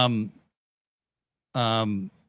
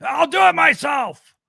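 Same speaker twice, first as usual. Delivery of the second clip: high, loud mock yell as Moxley; Moxley yelling at Will, high mock shout; imitated yell; 9 semitones higher, 4 dB louder than usual. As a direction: bark it like an enraged Moxley. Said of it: "yelled at Will and said".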